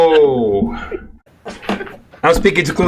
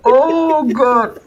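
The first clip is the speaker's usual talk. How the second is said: high, helpless laughing wail; crying with laughter; 13 semitones higher than usual. Real, not acted. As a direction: gasp it out through tears of laughter. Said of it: "I'm crying now".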